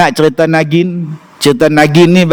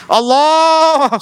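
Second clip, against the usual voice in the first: mock exclamation; 12 semitones higher than usual. Exclaiming at the Bilal.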